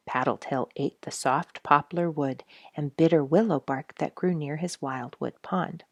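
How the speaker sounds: ordinary delivery, nothing marked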